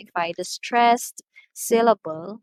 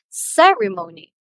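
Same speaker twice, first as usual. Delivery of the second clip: "ceremony" with CE high and loud, then falling; high-pitched stressed syllable; 7 dB louder than usual. Shown as a demonstration. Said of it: "if a syllable is stressed".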